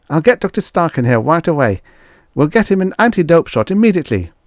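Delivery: high, loud, fast hurry; hurried assistant. Acted. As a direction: scramble to fix it. Frick voiced, hurried.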